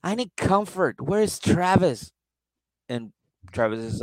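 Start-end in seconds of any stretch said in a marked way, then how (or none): 0.0-2.1 put-on higher, confused drunk whine; lost drunk. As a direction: wander back lost and needy. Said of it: "turns around and goes".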